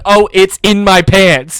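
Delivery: loud, slow, drawn-out mock panic; laughing friend quoting the spill, loud slow mock panic. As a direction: stretch it out like you can't believe the spill. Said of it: "How can I not laugh at somebody going".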